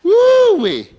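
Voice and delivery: high, loud whooping woo-wee. Real, not acted. Preacher to laughing congregation, loud high whoop. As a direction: whoop it out like a delighted exclamation. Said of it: "preacher exclaims after laughter".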